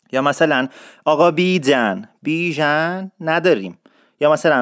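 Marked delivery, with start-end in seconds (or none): none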